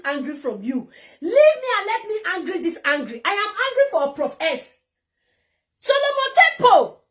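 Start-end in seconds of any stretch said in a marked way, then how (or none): 5.8-7.0 loud, high summons; calling out. Real, not acted. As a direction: call your opponent out by name. Calls out his opponent by name.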